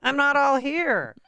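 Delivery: high, slow, spaced-out stoner voice. Retold or imitated. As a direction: drag it out like you're totally baked. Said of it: "'Cause he's stoned".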